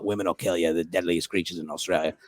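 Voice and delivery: low, soft Australian warning; Australian accent. Retold or imitated. Australian comic warning visitor off the beach, Australian accent. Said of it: "It's a good Australian accent".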